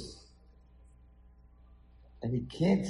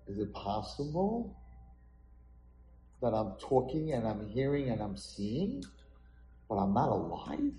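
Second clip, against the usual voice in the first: lower, softer puzzled question; awed wondering; 4 semitones lower, 6 dB quieter than usual. Acted. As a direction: ponder it quietly, rising at the end. Boy wonders in the dark.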